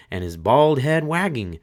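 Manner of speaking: ordinary delivery, nothing marked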